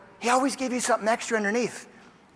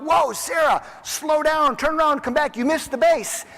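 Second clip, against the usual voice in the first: coach yelling; 8 semitones higher, slower than usual. Retold or imitated. First base coach yelling to Sarah on the bases, urgent shout. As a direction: call her back urgently. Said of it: "her first base coach yelled out".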